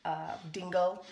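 ordinary delivery

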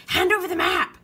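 high, loud pirate order; Braidbeard demanding. A narrator's reading. Pirate captain demanding the treasure map, loud order. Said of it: "said Braidbeard".

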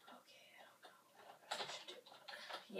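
breathy aside, barely audible; soft unsure whisper. Real, not acted. Muttered aside while playing.

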